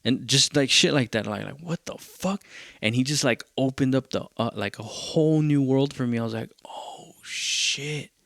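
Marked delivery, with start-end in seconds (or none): 1.6-2.4 high, hushed disbelief; amazed "what the...", kept under the breath; retold amazement. Retold amazement at producer's lesson. 6.6-8.1 drawn-out, whispery amazement; hushed realization, mostly air; retold awe. Retold awe at new world opening.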